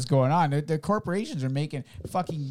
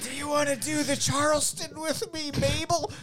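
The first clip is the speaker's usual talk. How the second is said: put-on high old-timey voice; 12 semitones higher, 3 dB quieter than usual. Old-timey voice inviting Mabel.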